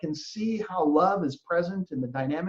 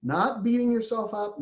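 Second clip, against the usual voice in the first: usual speaking voice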